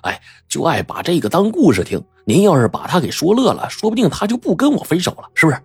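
wheedling with a put-on pout; mock-woeful pleading. A narrator's reading. Young man coaxing auntie for his girlfriend's sake, mock-woeful pleading. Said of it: "就学着哭丧个脸说".

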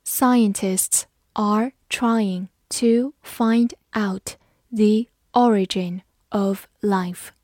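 slowly, word by word with gaps; measured, separated words, teacher modelling; slow read-along. Shown as a demonstration. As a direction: read it slowly for learners to repeat. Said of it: "跟着我慢读一遍".

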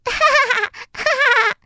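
high, far-out cackling laugh; squirrel's laughter. A narrator's reading. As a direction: laugh till nearly falling off. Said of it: "笑得差点从树上摔下来". (小松鼠尖声哈哈大笑)

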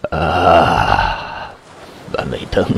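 low hoarse call, fearful; hoarse and afraid. A narrator's reading. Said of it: "他声音沙哑地呼唤道，声音里还有害怕的调子".

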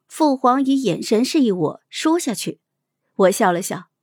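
usual speaking voice

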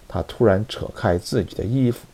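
ordinary delivery

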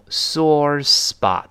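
sore spot said slowly, both initial S sounds stressed; slow alliteration. Shown as a demonstration. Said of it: "都是s，s". (慢读sore spot，强调两个s)

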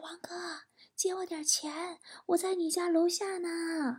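very soft, high stage whisper; furtive, barely audible phone plea; hushed voice. Acted. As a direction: whisper it as a secret request. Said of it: "我特别小声".

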